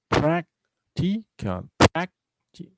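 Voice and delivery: "practical" slowly split, PRAC punched; stress on first syllable. Shown as a demonstration. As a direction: hit "prac" in "practical", pausing between syllables. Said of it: "on the first syllable".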